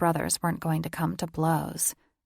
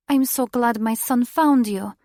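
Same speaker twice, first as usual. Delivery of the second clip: excited mother voice; 6 semitones higher, 7 dB louder than usual. A narrator's reading.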